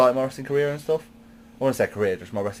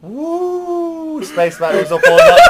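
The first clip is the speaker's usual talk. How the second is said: much higher woo then loud laughing brag; imitating Ric Flair; 10 dB louder, slower than usual. Ric Flair bragging about his wild night. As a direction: strut it like the Nature Boy. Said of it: "the next person he went".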